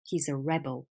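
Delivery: rebel as a noun, REB-ul; low-pitched rebel, stressed on REB; stress on first syllable. Shown as a demonstration. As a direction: punch the first half of rebel, weak ending. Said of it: "the stress changes".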